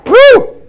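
high, loud whoop of joy; excited whoop. Real, not acted. Preacher cheers the congregation, high loud whoop. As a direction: let out a big celebratory woo.

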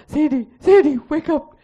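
high, loud call of her name; retold calling out. Coworkers calling the unconscious patient to wake up, loud and high. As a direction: call her back, urgent.

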